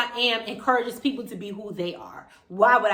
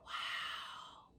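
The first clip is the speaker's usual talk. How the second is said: whispered, amazed reaction; hushed awe; 22 dB quieter than usual. Retold or imitated. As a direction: breathe it out very softly in wonder. Retelling an awed realization.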